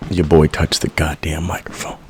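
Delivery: hushed aside, barely voiced; a real whispered aside. Real, not acted. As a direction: drop to a whisper for a quick aside. Aside after bumping the mic.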